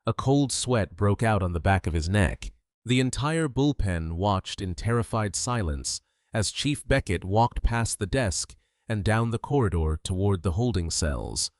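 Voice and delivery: usual speaking voice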